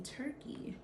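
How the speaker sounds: whispered punchline. Real, not acted. Telling viewers the teacher's plan, whispered. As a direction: drop to a whisper on the last word.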